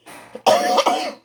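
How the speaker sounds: a real dry cough from an itchy throat. Real, not acted. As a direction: cough hard once, then stop. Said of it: "Sorry about the coughing".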